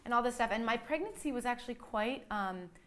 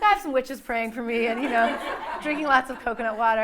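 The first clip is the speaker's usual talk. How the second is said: louder, laughing through the words; laughing reply; 3 semitones higher, 9 dB louder than usual. Retold or imitated. Telling the nurses about the praying witches, laughing loudly. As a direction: let the laugh spill into the answer.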